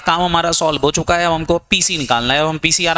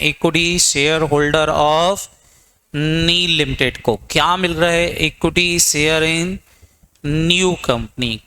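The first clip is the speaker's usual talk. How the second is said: slow dictation; much slower than usual. Shown as a demonstration. Teacher dictating notes to students.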